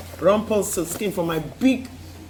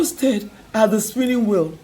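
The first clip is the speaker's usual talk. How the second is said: ordinary delivery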